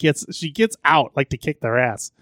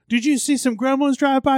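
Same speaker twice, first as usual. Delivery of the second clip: much higher, mock-innocent question; kids asking; 11 semitones higher than usual. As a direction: pitch it way up like a goofy kid. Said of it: "they're like".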